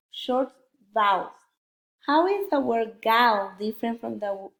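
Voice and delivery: normal talking voice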